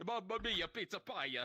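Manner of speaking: high, loud mock Italian accent. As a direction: sing it like a jolly Italian chef. Italian stereotype voice.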